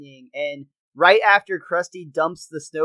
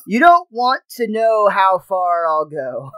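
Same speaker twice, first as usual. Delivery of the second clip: slow, louder menacing voice; ominous Bart voice; 6 dB louder than usual. Retold or imitated. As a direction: say it like a dark threat.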